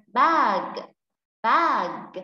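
"bag" with the final g clearly released; clear final consonant. Shown as a demonstration. Teacher models word endings for pupil, "bag" with a clear final g. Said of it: "chú ý cái phần âm đuôi".

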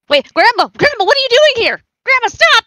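high, loud, urgent shout; alarmed calling out. Acted. Grandchild warns Grandma away from the goose, alarmed. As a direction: call out in rising alarm.

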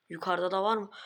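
ordinary talk

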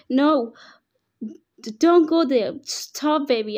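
loud, high panicked yelling, breaking off; frantic yell. Acted. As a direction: scream at your child to stop before the danger. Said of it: "I yelled".

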